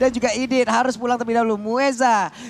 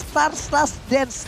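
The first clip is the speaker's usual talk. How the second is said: tongue-tied; 2 semitones higher, much slower than usual. Real, not acted. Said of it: "Sampai gua belibet nih ngomongnya".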